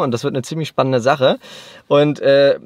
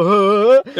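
mock confusion; 7 semitones higher, 8 dB louder than usual. Retold confused reaction.

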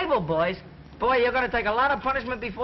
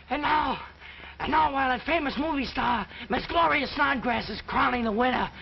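excited play-by-play, a bit higher; mock race announcer; 5 semitones higher than usual. Kid plays announcer for the gang's pretend horse race, hammed-up. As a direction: call the race like a radio announcer.

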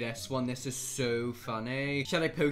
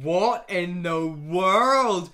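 slow, loud, drawn-out bafflement; loud, stretched-out disbelief; 2 semitones higher, 12 dB louder, much slower than usual. Real, not acted. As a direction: drag every word out in shock. Shocked by a strange post.

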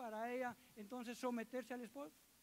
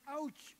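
a playful pained ouch; mock ouch; 3 semitones higher than usual. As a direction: say ouch as if it stings a little. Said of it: "algunos, eh, casados dicen". (un "ouch" de dolor fingido)

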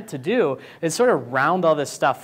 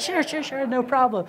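much higher, hurried agreement; mock eager yes-man; 11 semitones higher than usual. Designers caving to the client, high hurried mock voice. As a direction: agree to anything just to please.

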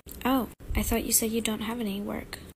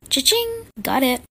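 much higher, triumphant; gleeful; 4 semitones higher, 5 dB louder than usual. Acted. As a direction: crow it like winning. Snaps embarrassing photo.

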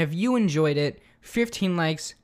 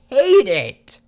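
disgusted outburst; 9 semitones higher, 8 dB louder than usual. Real, not acted. Smelling the vinegar.

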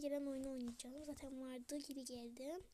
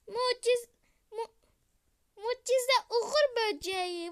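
higher, louder, near-crying delight; squealing with joy; 6 semitones higher, 13 dB louder, much slower than usual. Real, not acted. Finding the miracle ladybug, squealing near tears. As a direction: shriek it, overwhelmed by luck. Thrilled at rare pet find.

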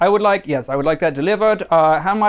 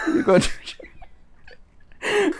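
slow breathy whisper; 4 dB quieter, much slower than usual. Pretend. Caller warning the clerk in a slow whisper. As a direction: hush it out slowly.

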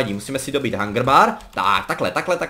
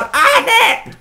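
much higher, laughing cry of dismay; surprised yelp; 7 dB louder than usual. Real, not acted. Gamer gets caught off guard and yelps high with a laugh. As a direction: yelp as the damage lands. Sudden hit in game.

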